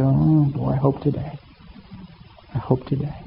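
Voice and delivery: quiet, wistful wish murmured to himself; a father hoping under his breath; soft hopeful longing. Retold or imitated. Father longing, watching the road.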